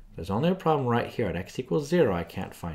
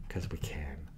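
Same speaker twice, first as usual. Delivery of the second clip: whispered aside; 6 semitones lower, 10 dB quieter, slower than usual. Real, not acted. Playful aside while teaching.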